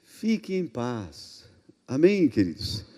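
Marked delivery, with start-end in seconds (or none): 0.0-1.5 soft, low and very slow; soothing reassurance. Real, not acted. Pastor reassuring anxious disciplers, soothing. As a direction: settle them down gently, softly and slowly.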